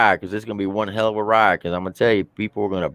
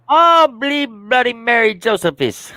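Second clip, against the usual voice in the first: very high, drawn-out mock Irish cry; hammed-up tipsy Irishman, squeaky-high and slow; excited Irish accent; 13 semitones higher, slower than usual. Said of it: "good and excited, get a couple of drinks".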